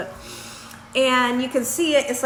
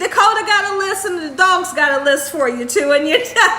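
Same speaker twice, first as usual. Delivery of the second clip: loud, high, giggly delivery; amused quote dissolving into laughter; laughing retold quote; 5 semitones higher, 5 dB louder than usual. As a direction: tell the punchline while cracking up. Quotes husband's joke, laughing.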